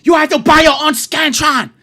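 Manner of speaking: high, very loud screamed demand; screaming teacher. Retold or imitated. Teacher orders the class to buy Scantrons, screaming. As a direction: scream it as a hammed-up teacher impression. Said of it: "She's screaming that".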